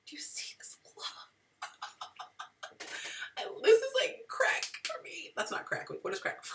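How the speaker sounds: hushed giggling whisper. Real, not acted. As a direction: drop to a secret whisper. Whispered aside, then laughing.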